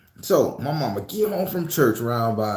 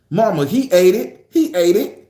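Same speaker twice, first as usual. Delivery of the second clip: tattling siblings; 4 semitones higher, 5 dB louder than usual. Retold or imitated.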